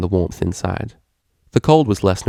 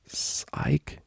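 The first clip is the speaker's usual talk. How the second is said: whispered, breathless disbelief; croaked; 10 dB quieter than usual. Acted. Vinyl, stunned by the name, croaking to herself. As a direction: barely get the name out. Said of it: "croaked Vinyl".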